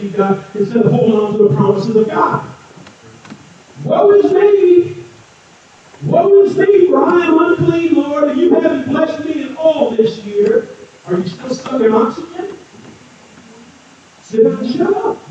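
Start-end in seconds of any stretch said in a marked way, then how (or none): 3.8-10.7 mock lament. Mocking a complaining believer. 14.2-15.2 mock rebuke. Playful jab at congregation.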